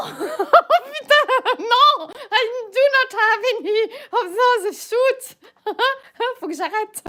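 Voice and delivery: high, laughing through the words; cracking up, can't stop; laughing fit. Real, not acted. Cracking up at own reading.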